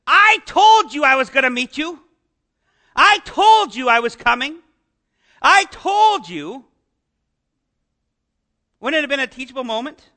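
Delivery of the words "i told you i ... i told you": loud, slowing, scolding I-told-you; mock scolding I told you. Pastor voicing a scolding Jesus to the disciples, loud and slowing. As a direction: rub it in like a teacher scolding. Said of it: "a teachable moment for Jesus to say".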